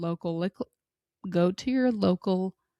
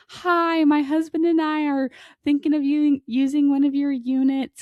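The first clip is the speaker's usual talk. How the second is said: higher, louder, put-on polite caller voice; sweet phone voice; 8 semitones higher, 6 dB louder than usual. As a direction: mimic mom's overly sweet customer voice. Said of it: "is like".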